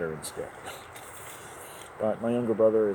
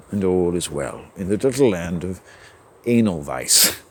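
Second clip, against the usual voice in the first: normal talking voice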